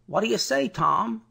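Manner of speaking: high tense question; a wary guard calling; alert guard. Acted. Guard voiced in narration.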